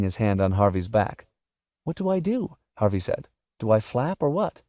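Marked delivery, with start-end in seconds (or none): 1.8-4.6 higher-pitched anxious questions; worried kid's voice; nervous boy. Acted. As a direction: ask it high and unsure. Boy nervous on roof edge.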